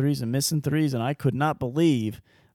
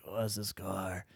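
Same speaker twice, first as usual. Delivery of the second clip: a quiet, lower put-on wife voice; a hint of a sleepy wife asking; mock wife voice; 5 semitones lower, 15 dB quieter, much slower than usual. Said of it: "she doesn't sound like that".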